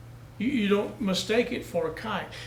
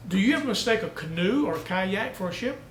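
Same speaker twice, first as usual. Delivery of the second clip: ordinary delivery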